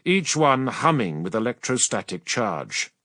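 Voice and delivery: ordinary talk, nothing marked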